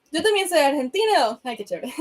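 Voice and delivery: playful mock Argentinian Spanish accent; hammed-up Argentinian Spanish, just for fun; bad Argentinian accent. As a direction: put on a badly faked Argentinian accent. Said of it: "that was a really bad Argentinian accent".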